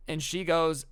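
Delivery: ordinary talk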